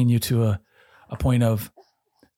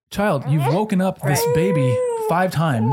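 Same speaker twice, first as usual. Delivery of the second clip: higher, slow, fed-up scolding; exasperated parent; 6 semitones higher, slower than usual. Retold or imitated. Parent losing patience with a daughter who keeps waking the baby, exasperated and slow. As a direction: spell it out to a child at the end of your rope. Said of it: "you get to the end of yourself".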